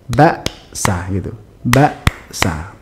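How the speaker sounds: "Baksa" cut after ba, a beat of silence, then sa; one-beat glottal stop. Shown as a demonstration. Teacher demonstrates hamzah, a clean gap splitting "Baksa". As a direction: stop the voice sharply inside "Baksa". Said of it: "Sehingga bacanya itu".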